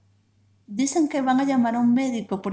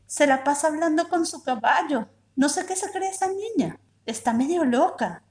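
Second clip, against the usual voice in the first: exasperated mother; 6 semitones higher than usual. A narrator's reading. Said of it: "mother complains about child".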